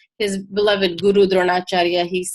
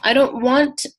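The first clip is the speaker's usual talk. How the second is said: ordinary delivery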